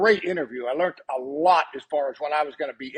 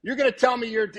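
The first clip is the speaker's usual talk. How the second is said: laughing protest; 5 semitones higher than usual. Retold or imitated. Retold protest, laughing through it.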